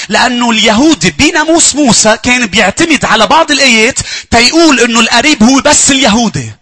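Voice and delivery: loud, high fervent sermon voice; preaching at full force. Acted. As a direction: proclaim it to the whole church. Preacher proclaims to congregation.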